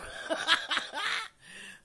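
high, loud cackle; laughing hard at her own story. Real, not acted. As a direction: burst out laughing.

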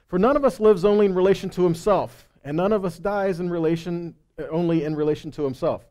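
scripture reading. Acted. Reads scripture aloud.

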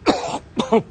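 sudden harsh coughing fit after swallowing a fly; choking cough. Real, not acted. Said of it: "excuse me while I choke".

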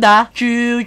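ordinary delivery

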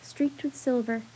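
plain reading voice

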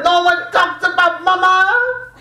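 loud, high, slow wailing protest; enraged singsong. Retold or imitated. Little coworker losing it over mama talk, loud high wail. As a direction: howl it like he lost his mind. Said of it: "the Cabalito loses his mind".

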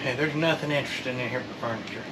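ordinary talk

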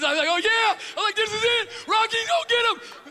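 high, loud excited shout; excited cheering. Retold or imitated. Fan cheering Rocky on at the TV, loud excited shouting. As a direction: fire up like a fan.

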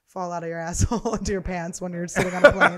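words laughed through, loud and high; telling a gross story and cracking up; laughing. Real, not acted. Laughing at her own joke.

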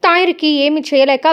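ordinary delivery, nothing marked

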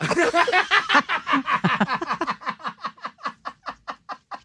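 real laughing fit; big high laugh. Real, not acted. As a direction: crack up hard. Laughing at the story's punchline.

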